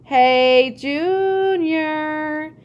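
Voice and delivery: loud, high singsong taunt; teasing call. A narrator's reading. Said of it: "teased me, calling".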